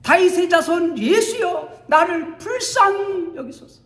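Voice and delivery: high, loud desperate shout. Acted. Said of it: "더 큰 소리로 외칩니다".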